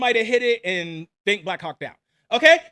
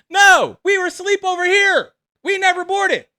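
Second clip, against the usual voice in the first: urgent protest; 7 semitones higher, 6 dB louder than usual. Retold or imitated. Protesting that they are alive.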